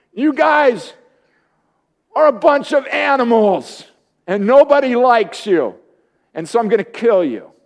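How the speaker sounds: a hint of a mocking Goliath; giant's taunt. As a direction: taunt them from up high like a bully.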